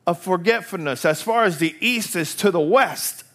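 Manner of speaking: high, loud revival preaching; fervent sermon at the top of the voice; full-force preaching. Acted. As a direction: preach it out to the back row.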